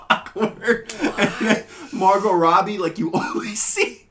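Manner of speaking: giggling as she describes it; breaking up with laughter mid-sentence; laughing. Real, not acted. Laughing while describing clip.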